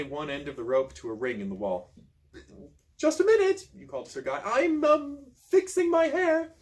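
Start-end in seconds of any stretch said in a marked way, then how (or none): 3.0-3.7 high, loud falsetto call; faking Marion's voice. Acted. Disguised hero calling to Sir Guy, high falsetto. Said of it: "trying to sound like Marion". 4.4-6.5 high, slow falsetto with a hesitant um; faking Marion's voice. Acted. Stalling Sir Guy through the door, high falsetto lady voice. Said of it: "trying to sound like Marion".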